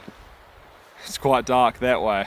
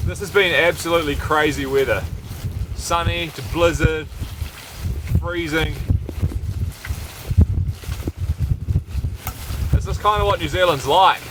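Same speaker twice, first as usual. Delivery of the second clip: slightly projected, higher voice; raised voice in blizzard; 4 semitones higher than usual. Real, not acted. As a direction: speak up over the blizzard. Outside again in blizzard conditions.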